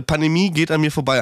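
ordinary talk, nothing marked

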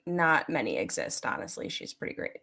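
a hint of a low, mumbling fiance; imitating Mike. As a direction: do the fiance deadpan, trailing off. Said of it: "He'd probably be like".

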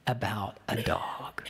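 whisper. Real, not acted. Sermon aside on the rant.

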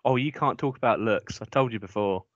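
ordinary delivery

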